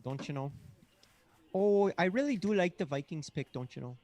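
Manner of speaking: high, sing-song mock Minnesotan; Minnesota accent. Host praising the Vikings pick in mock Minnesotan.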